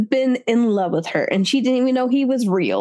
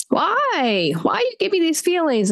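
mock anguished why; 7 semitones higher than usual. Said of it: "and you're just like".

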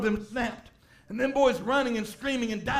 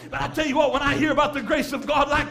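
louder, higher, shouting the sermon home; full-force preaching; 3 semitones higher, 5 dB louder than usual. Acted. Preacher to congregation, on the grace that keeps them: loud preaching shout. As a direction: drive it like a revival preacher at full cry.

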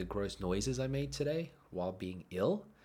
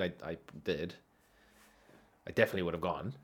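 ordinary talk, nothing marked

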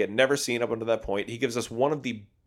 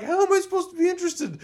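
laughing exasperation; faster than usual. Retold or imitated. Exasperated rhetorical question.

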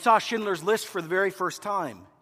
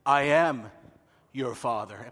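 low, slow, deep villain reveal; Darth Vader; 5 semitones lower, slower than usual. Retold or imitated. Vader revealing himself to Luke, low ominous voice. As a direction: drop the voice for the reveal. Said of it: "And he said".

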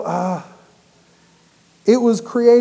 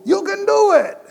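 much higher, louder Cajun-style holler; high loud Cajun cheer; Cajun crowd cheer; 10 semitones higher, 5 dB louder than usual. Retold or imitated. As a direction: cheer him on like the guy in the stands. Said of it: "God is putting you out there to go".

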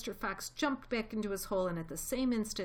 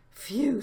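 loud, high blown-out phew; father fox relieved after the escape; 6 semitones higher, 9 dB louder than usual. A narrator's reading. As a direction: breathe out the relief.

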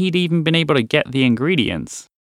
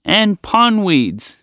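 frog voice; 8 semitones higher, much slower than usual. Acted. Frog listing ingredients.

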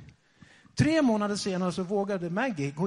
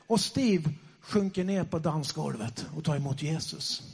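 ordinary talk

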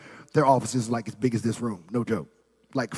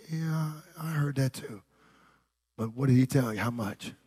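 guarded, hushed; 7 dB quieter than usual. Retold or imitated. Reluctant giver asking spouse how much, guarded and hushed. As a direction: imitate a reluctant spouse speaking very softly. Wary spouse asks the amount.

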